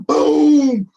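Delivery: put-on a booming crash for the kids; sudden loud boom; loud boom effect. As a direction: shout the crash big. Pumpkin crash sound effect.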